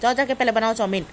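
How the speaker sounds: usual speaking voice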